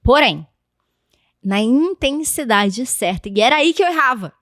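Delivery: usual speaking voice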